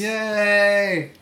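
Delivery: high, loud, drawn-out cheer; excited cheer. Real, not acted. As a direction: cheer for the show's opening. Guest cheers the episode welcome.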